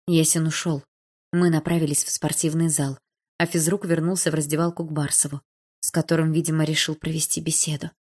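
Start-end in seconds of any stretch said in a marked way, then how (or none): none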